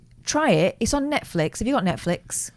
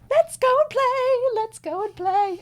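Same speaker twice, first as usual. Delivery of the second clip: high, slow coaxing sing-song; coaxing cat voice; 3 dB louder than usual. Retold or imitated. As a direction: coax a cat to play.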